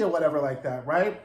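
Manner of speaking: normal talking voice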